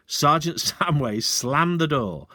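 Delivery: plain reading voice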